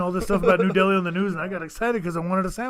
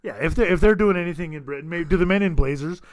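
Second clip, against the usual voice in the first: ordinary talk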